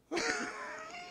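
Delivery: a high burst of laughter. Real, not acted. Pastor laughing at his joke before the church. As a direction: laugh at your own joke.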